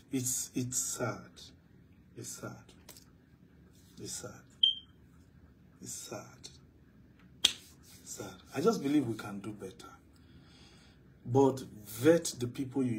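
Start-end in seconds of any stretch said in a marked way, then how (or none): 0.1-8.3 fading lament. Real, not acted.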